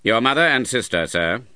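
put-on stern old uncle. A narrator's reading. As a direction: lay down terms to the nephew.

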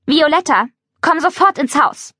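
high, sharp stern call; cutting voice. Acted. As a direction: snap her name from the balcony. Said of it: "schneidende Stimme".

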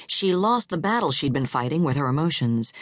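plain reading voice

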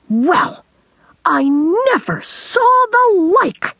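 high, drawn-out storybook exclamation; terrific yell. A narrator's reading. Girl spots a rusty can, amazed high yell. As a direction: exclaim in amazement, pitch soaring. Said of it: "gave a terrific yell".

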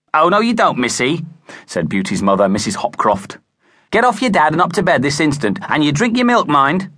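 high, firm motherly telling-off; mother packing daughter off to bed; scolding mother. A narrator's reading. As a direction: shoo her to bed.

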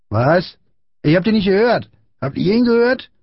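high-pitched feigned deafness; mocking bully. A narrator's reading. Big boy asking his gang about Friedrich, high mocking voice. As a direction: play to your gang, taunting.

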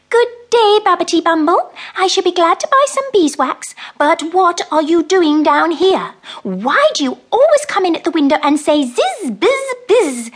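high, loud, severe little mouse; prim and stern, a mouse who wants a broom. Acted. Mrs Tittlemouse sternly questions Babbitty Bumble, high loud mouse voice.

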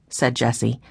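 usual speaking voice

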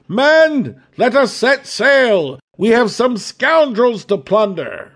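rally the crew to set sail; hearty captain shouting orders; calling to the crew. Acted. Captain calls to his crew.

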